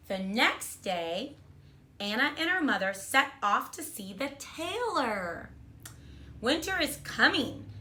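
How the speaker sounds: put-on reading a storybook to children; bright, expressive read-aloud; kindergarten teacher voice. A narrator's reading. Teacher reads picture book aloud.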